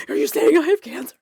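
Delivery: high, loud, acted alarm; flipping out. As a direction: replay a panicked demand for a straight answer. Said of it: "I'm flipping out".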